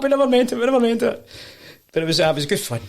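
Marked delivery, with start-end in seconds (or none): 0.0-1.3 high-pitched mock backpedalling; hurried excuse, mimicked high; imitated protesting. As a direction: plead innocence in a raised voice. Hecklers backtrack when confronted.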